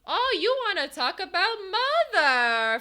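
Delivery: high, loud hammed-up quote, stretching out mother; mock movie quote. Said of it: "people are like".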